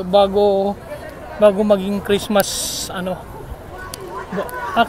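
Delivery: usual speaking voice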